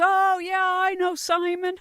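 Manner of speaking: high, loud, drawn-out exclamation; delighted recognition. Retold or imitated. Host retelling Jeremy's reply about knowing the guest, excited and loud. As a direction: imitate someone thrilled to know the name.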